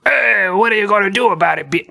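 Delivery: hammed-up French accent, pitched high; terrible French accent. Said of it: "That's a terrible French accent".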